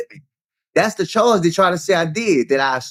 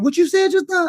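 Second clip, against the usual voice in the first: much higher, jittery voice of a paranoid friend; 12 semitones higher than usual. Retold or imitated. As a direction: play the tweaking friend who hears things. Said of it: "That boy look up and said".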